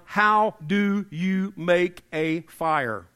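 each word spaced out deliberately; slow, emphatic. Real, not acted. Preacher poses a survival question in a snowstorm, slow and emphatic. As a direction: drive the question home word by word.

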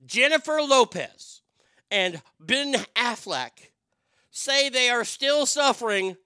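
loud, high, slow yelling; slow, loud, high-pitched tirade; furious rant. Real, not acted. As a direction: bellow each word out in outrage. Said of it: "you wanna talk about angry".

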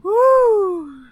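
high, loud whoop; excited whoop. Real, not acted. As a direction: cheer like the crowd went wild. Hyped segment kickoff.

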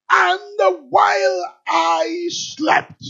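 "And while I slept" stretched out slowly, high and loud; drawn-out scripture quote. Acted. Preacher quoting the mother in First Kings, slow, high, drawn-out cry. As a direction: draw out each word of "And while I slept", loudly.

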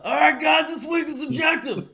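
high, loud shouted pep talk; hammed-up shouting new boss; shouting boss impression. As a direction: bark it like a drill sergeant boss.

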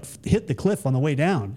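ordinary talk

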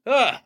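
high, loud ugh of disgust; frustrated groan. Real, not acted. Groaning after ranting about the website, loud. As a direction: let out a fed-up ugh. Ending his rant.

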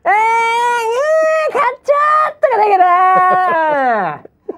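overwhelmed dad yelling in disbelief at the win; impression of the father. Father shouting as his son wins, exaggerated impression. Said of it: "むちゃくちゃ似てます".

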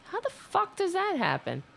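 high, disbelieving outburst; can't believe it happened; exasperated. Real, not acted. Streamer upset show had no sound.

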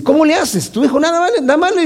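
high, astonished friend asking how; incredulous high-pitched question; amazed pastor. Retold or imitated. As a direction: play the stunned fellow pastor, way up high.

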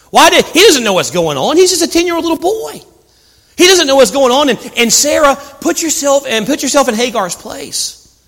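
high, pleading preacher voice; impassioned preaching. Acted. Preacher pleading for a ten-year-old boy, impassioned.

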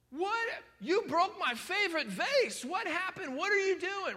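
high, loud outraged parent; shocked parent. Retold or imitated. Preacher playing a parent finding a broken vase, outraged. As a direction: play the parent who finds the broken vase.

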